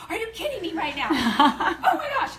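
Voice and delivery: high, loud excited disbelief, laughing. Retold or imitated. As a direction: squeal it out, thrilled and laughing. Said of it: "I'm like".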